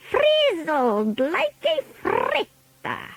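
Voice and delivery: high, drawn-out witch voice; Grand High Witch. A narrator's reading. Witch leader gloating to her audience, high slow villain voice. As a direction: stretch it out high and slow, with relish. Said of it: "said the Grand High Witch".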